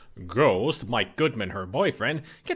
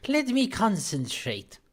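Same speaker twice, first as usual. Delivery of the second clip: mystic medium voice; 8 semitones higher, 4 dB quieter than usual. Acted. Medium asks for quiet.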